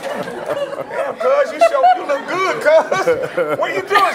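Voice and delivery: high, loud, laughing hype to a cousin; excited laughing greeting. Retold or imitated. Greeting his glowing cousin, high and laughing. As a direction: gush with a laugh, way up in pitch. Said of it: "I'm like".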